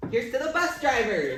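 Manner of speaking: loud laughing cheer. Acted. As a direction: shout the toast gleefully. Joking toast, cheering.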